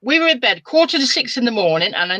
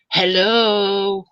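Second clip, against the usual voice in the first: put-on ghostly young man's hello. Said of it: "a young man's voice".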